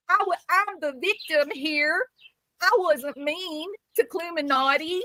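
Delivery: high, whiny mock victim voice, gasping in fake shock; mocking Brittany. Mocking Brittany's reply to him, in a whiny mock-victim voice. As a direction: ham up the wronged innocent. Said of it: "I can hear it now".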